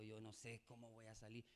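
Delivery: very soft, low admission; hushed low quote of oneself; subdued retold confession. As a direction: confide it quietly, retelling the moment. Retold private chat about debts.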